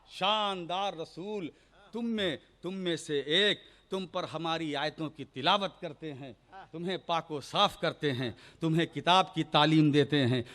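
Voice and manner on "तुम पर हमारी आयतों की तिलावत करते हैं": ordinary talk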